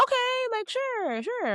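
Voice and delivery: a squeaky, doubtful agreeing; hesitant high okay. Retold or imitated. Telling the Uber driver okay, hesitant high-pitched sure. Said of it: "And so I was like".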